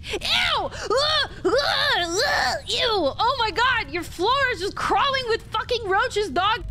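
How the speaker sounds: high-pitched grossed-out cries. Real, not acted. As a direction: recoil from crawling roaches. Disgust at roaches on floor.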